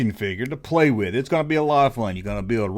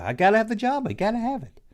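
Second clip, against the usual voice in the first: mock begging kid; 7 semitones higher, faster than usual.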